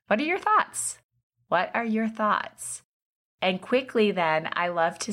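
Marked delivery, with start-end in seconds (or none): 0.1-1.0 high, bright rising ask; eager question. Shown as a demonstration. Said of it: "the follow-up is". 1.5-2.8 slowed-down, deliberate ask; slow question. Shown as a demonstration. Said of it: "the follow-up is".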